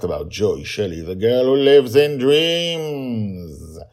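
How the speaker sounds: loud, high announcer opening; announcer intro. Acted. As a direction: boom out the show's welcome. Podcast intro announcer read.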